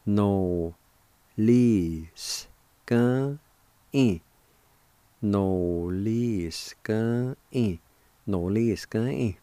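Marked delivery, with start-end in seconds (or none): 0.0-4.2 no, lis, gan, in with clear gaps; syllable-by-syllable pronunciation. Shown as a demonstration. Teacher breaking down the word intending, slow separate syllables. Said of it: "it's pronounced like this". 5.2-7.8 no-lis-gan-in drawn out; slow pronunciation. Shown as a demonstration. Teacher showing the word for intending, slow and drawn out. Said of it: "it's pronounced like this".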